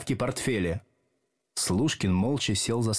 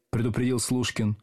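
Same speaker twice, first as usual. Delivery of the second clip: plain reading voice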